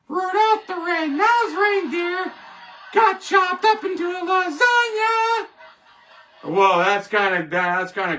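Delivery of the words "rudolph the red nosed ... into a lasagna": jolly Santa singing out the bad news; Santa Claus voice. Vlogger as Santa breaking Rudolph news, high sung voice. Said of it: "Say like Santa Claus".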